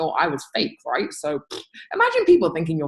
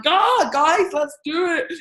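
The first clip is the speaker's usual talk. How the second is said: mock shout; 9 semitones higher, slower than usual. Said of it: "shout at you all and I'm like".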